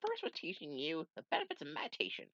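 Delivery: normal talking voice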